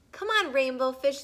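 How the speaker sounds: high, loud call across the water. Acted. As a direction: shout to a friend to come play. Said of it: "they called".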